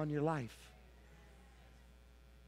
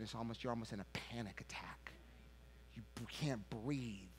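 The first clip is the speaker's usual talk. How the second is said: breathless panicky whisper; 6 semitones lower, 12 dB quieter than usual. Shown as a demonstration. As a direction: whisper it like you can't breathe. Describing waking in panic.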